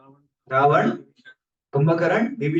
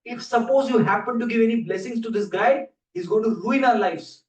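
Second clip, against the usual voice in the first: higher, louder desperate begging; urgent plea; 6 semitones higher, 4 dB louder than usual. Acted. Devas beg Brahma, higher and louder. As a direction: gods begging Brahma not to bless him.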